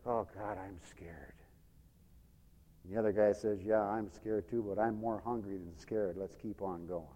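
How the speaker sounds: low, soft, stealthy narration; hushed storytelling. A narrator's reading. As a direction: tell it under your breath, as if creeping along. Hushed storytelling of fearful lepers.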